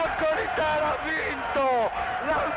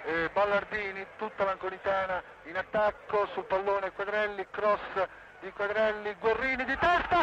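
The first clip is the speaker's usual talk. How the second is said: live radio play-by-play, rising to goal cries; breathless italian goal commentary; 5 semitones higher than usual. Real, not acted. (radiocronaca concitata del gol)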